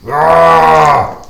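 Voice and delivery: loud high bellow calling out; shouting. Acted. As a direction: roar it across the swamp. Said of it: "Orgon's like".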